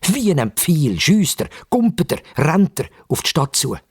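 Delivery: ordinary delivery, nothing marked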